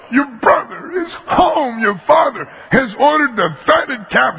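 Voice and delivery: high, slow breathless news; excited servant. Acted. Servant tells the older brother, high and thrilled. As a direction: blurt out the good news, thrilled. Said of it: "the servant goes".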